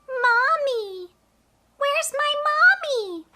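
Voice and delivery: high little calf voice crying for mommy; baby calf calling. Acted. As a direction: call out like a lost baby calf.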